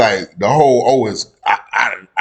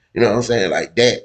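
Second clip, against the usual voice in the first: ordinary delivery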